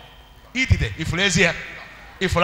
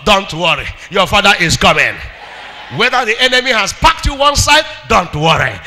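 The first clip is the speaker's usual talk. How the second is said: ordinary delivery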